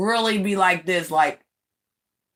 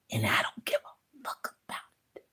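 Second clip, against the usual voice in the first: soft, fast whisper; a real whisper, said quickly; 8 semitones lower, 15 dB quieter, faster than usual. Real, not acted. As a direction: drop it to a whisper. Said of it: "Yeah, I said it".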